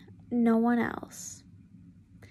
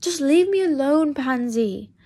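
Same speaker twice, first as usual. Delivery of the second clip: loud, higher snap; angry; 3 semitones higher, 5 dB louder than usual. Acted. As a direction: shout her away. Draco snapping at Pansy.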